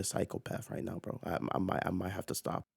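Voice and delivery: dropped to a near hush at night; quiet late-night murmur. Real, not acted. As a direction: pull back so you don't wake anyone. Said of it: "waking up my parents".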